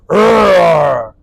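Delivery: loud, drawn-out imitated growl; sloth groan. Imitating a sloth character from the movie, loud drawn-out groan. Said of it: "sloth joint that talks like this".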